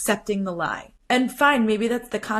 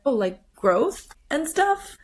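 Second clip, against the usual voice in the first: slow, higher-pitched tentative guess; tentative trying; 6 semitones higher, slower than usual. Retold or imitated. Father guessing at what his daughter's tattoo means, tentative. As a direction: imitate someone trying to understand, unsure. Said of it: "And he tries".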